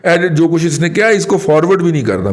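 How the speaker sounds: high-pitched re-enactment of a warning; retold warning. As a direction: act out warning someone off, pitched well up. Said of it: "میں نے آپ کو پہلے دن warning کی تھی". (اونچے سُر میں تنبیہ کی نقل)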